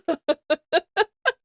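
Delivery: high breathless laughing; laughing fit. Real, not acted. Cracking up at her own pleading, helpless laugh.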